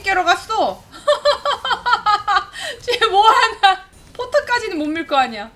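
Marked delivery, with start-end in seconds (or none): none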